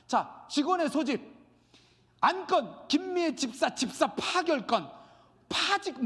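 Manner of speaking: high, emphatic chairman announcing a verdict; mock official ruling. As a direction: pronounce each agenda item like a ruling. Acting summary dismissal at church meeting. (회의에서 판결을 선포하듯 높은 목소리로)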